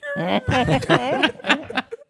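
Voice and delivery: high, repeated squeaky trailer noise; imitated trailer noises. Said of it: "It's, like, making noises, like".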